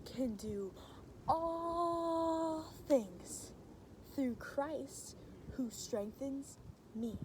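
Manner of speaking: slow, quiet, drawn-out verse; soft and slow for little kids; slow soft recitation with motions. Shown as a demonstration. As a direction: say it gently while doing motions. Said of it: "point it at ourselves and go".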